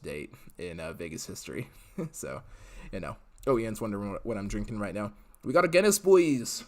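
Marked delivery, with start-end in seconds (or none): 5.4-6.6 loud, hammed-up Irish pub cheer; a deliberately bad Irish accent, shouted to the boys. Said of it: "my Irish accent is rather horrible".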